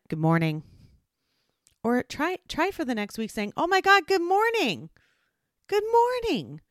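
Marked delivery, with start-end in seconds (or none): 0.1-0.6 low, perfunctory good morning; unenthused greeting. Shown as a demonstration. Everyday greeting said for the sake of it, unenthused. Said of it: "If you're just like". 3.5-6.6 very high, gushing good morning; delighted smiling greeting. Shown as a demonstration. Trying a cheerful morning greeting for a week, delighted. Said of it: "And smile".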